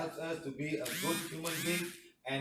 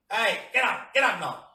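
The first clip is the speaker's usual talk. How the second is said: loud, higher-pitched order; wake-up shout; 5 semitones higher, 7 dB louder than usual. Shown as a demonstration. Showing how to rouse sleeping people, demonstrated shout. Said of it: "somebody got to shout to wake people up".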